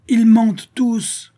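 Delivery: very high, loud, drawn-out accusation; shouting over everyone. A narrator's reading. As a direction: bellow it slowly above the tumult. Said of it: "éleva la sienne au-dessus de toutes les autres". (cri accusateur)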